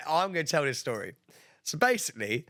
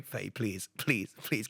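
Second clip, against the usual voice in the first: low, soft, retold plea; hushed pleading; 6 semitones lower, 6 dB quieter, much slower than usual. Man begging upset friend not to raise her voice, hushed pleading. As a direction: quietly beg a friend, as if retelling it.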